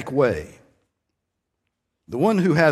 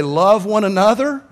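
louder and higher insistent plea; urging counselor; 6 semitones higher, 6 dB louder than usual. Retold or imitated. Counselor urging husband and wife, insistent loud plea. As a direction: press it on the quarrelling couple.

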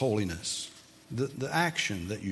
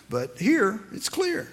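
ordinary talk

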